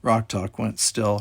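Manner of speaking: plain reading voice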